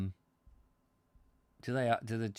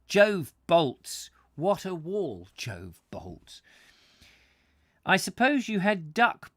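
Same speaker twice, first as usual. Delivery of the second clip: raised, rhetorical read-aloud with punched exclamations; story reading; 5 semitones higher, 7 dB louder than usual. A narrator's reading. Soldiers swap tales of wet nights on the wall, theatrical reading.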